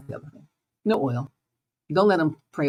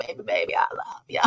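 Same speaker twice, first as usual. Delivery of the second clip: whisper; 5 dB quieter, faster than usual. Pretend. Whispered love line after loud one.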